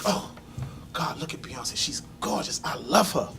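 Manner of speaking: whisper-soft swooning at the TV; dreamy hushed admiration. Retold or imitated. Man swoons over [name] on TV, hushed and soft. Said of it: "And I'm like".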